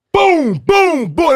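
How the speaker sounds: loud, high booming hits; high-pitched, punched sound effect; imitated thunder booms. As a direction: boom it out like thunder striking. Imitates the shock like thunder hitting.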